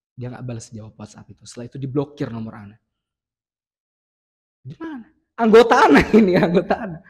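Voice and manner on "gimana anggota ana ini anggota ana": incredulous laugh, pitch up; laughing in disbelief. Real, not acted. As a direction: laugh at how absurd it was. (tertawa heran tak percaya)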